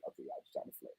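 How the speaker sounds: very soft, nearly voiceless private thought; thinking to himself. Retold or imitated. Telling what he thought on seeing the girls, barely voiced inner thought. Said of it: "I'm thinking".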